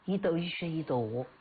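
Changsha dialect, 河 said like 鹅; Hunan dialect. Shown as a demonstration. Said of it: "用湖南话怎么读啊". (用湖南长沙话念)

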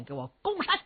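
high, sharp battle order; commanding. A narrator's reading. Strategist orders the army to storm the mountain, commanding. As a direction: bark the order to charge. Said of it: "徐茂公传下命令".

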